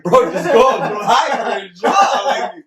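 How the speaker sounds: high, loud laughing yell at little brother; exasperated shout. Retold or imitated. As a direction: shout it at him, half laughing. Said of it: "We're like".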